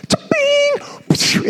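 high ringing bat ping; imitated bat crack. Man re-enacting his home run, high imitated ping. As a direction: make the ping of a ball off the bat.

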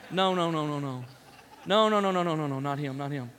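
repeated moaned protest, sinking low; moaning refusal that fades away; mock moan. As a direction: imitate a dismayed dinner table. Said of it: "you get this moan".

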